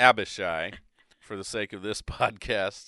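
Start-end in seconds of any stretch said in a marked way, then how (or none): 0.0-0.7 Abishai as AB-uh-shy, loud; settled pronunciation. Shown as a demonstration. Host settling on Abishai for the podcast, AB-uh-shy. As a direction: hit AB firmly in Abishai. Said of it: "I'm gonna say".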